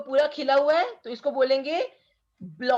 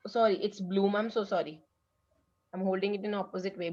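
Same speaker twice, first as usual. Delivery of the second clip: softer, lower, embarrassed correction; sheepish apology; 3 semitones lower, 6 dB quieter, much slower than usual. Real, not acted. Teacher apologising to pupils for holding the card wrong, softly. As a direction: quietly own the slip. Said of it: "teacher apologising for wrong card".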